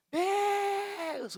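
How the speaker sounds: a very high, ringing cry of Deus; father crying out to God in the shower, a piercing high cry; desperate cry to God. Retold or imitated. Father in the cold shower crying out to God, a piercing high wail. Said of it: "o pai começou a gritar".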